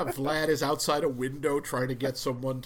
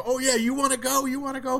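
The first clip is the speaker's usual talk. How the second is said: louder, cocky mock frat boy challenge; frat boy taunt; 8 semitones higher, 5 dB louder than usual. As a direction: puff up like a frat boy picking a fight, half in jest. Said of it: "the sort of frat boy kind of".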